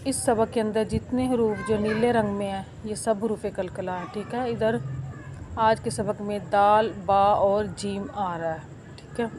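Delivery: ordinary talk, nothing marked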